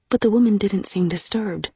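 ordinary delivery, nothing marked

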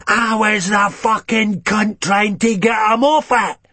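understated raging scream; screamed in her face. Acted. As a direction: yell it in her face, outraged. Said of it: "screamed in her face".

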